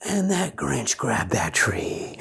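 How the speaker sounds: hushed, breathless whisper. A narrator's reading. Narrator whispering to the kids as the Grinch grabs the tree. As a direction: whisper it like a secret. Tense whispered story moment.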